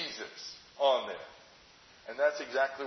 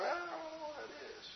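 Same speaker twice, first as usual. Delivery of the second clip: scared; 12 semitones higher, 15 dB quieter than usual. Pretend. Frightened disciple in the dark.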